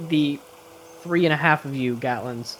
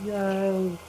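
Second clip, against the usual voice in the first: softer, drawn-out "Yo" call; far-off answering voice; 6 semitones higher, 4 dB quieter than usual. Acted. Game master imitates the phantom voice answering, a distant call. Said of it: "you hear another voice going".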